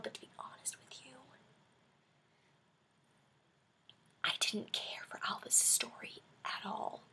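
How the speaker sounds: hushed confession to the camera; whisper. Real, not acted. Vlogger admitting she disliked a storyline, whispered. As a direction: whisper it like a secret. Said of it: "confessing an unpopular opinion".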